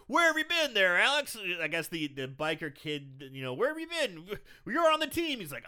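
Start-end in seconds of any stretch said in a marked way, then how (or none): 0.1-1.4 high, loud mock-coach holler; coach calling out. Recapper as coach calling a missing player, high holler. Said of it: "And the coach is like". 3.5-5.4 high-pitched mock-coach scolding; coach calling out. Recapper as coach chiding his absent wrestler, high holler. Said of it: "And the coach is like".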